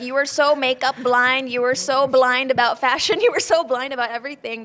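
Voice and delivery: ew, scolding her past self with a laugh. Real, not acted. Guest recalling her old photos, loud laughing disgust. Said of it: "cringe banget".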